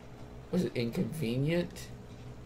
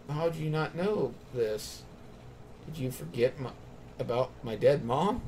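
ordinary talk, nothing marked

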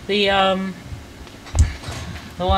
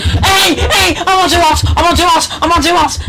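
shouting; 12 semitones higher, 7 dB louder than usual. Real, not acted. Panicked game yelling.

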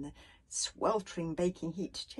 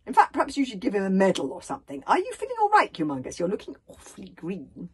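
eager chattering; 11 dB louder than usual. A narrator's reading. Hiccup fusses over the bodyguard looking green, chattering. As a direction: rattle on loudly, pitch leaping on the question. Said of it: "with Hiccup chattering all the time".

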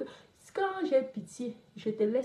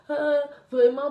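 mock exclamation; 4 semitones higher, 10 dB louder, slower than usual. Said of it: "les gens n'ont pas arrêté de me parler".

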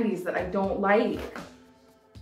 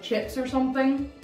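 normal talking voice

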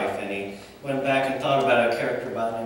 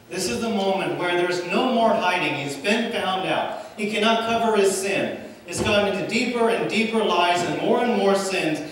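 full-force preaching; 5 semitones higher than usual. Acted. Preacher to congregation on David's confession, building with full force. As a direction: drive the climax home to the congregation.

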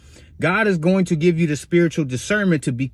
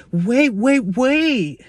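higher-pitched mock female coworker; imitating pleading woman; 7 semitones higher, much slower than usual. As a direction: plead like a clingy coworker, mockingly. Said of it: "she goes like this".

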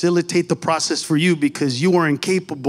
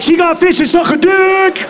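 high, loud voice of a shocked friend; acted high-pitched shriek of disbelief; astonished yell; 10 semitones higher, 4 dB louder than usual. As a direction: holler it in scandalized amazement.

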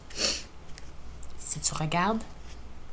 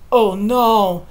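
ordinary delivery

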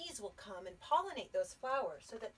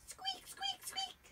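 much higher, tiny squeaking mouse; tiny high mouse squeaks; slower than usual. Acted. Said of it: "said Mouse".

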